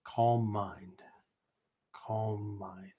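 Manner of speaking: "calm mind" said low and very soft, slowly; soft calm murmur. Shown as a demonstration. Teacher voicing the rope's inner calming. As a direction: soothe yourself with "calm mind". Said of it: "What I'm saying is".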